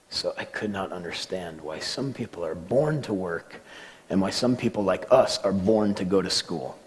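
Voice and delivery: ordinary talk, nothing marked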